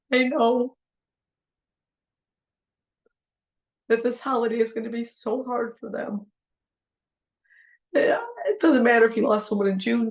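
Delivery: fighting back tears while speaking; tearful. Real, not acted. Tearing up while telling grieving friends they're not alone. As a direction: voice catching with real tears. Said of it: "when I start crying".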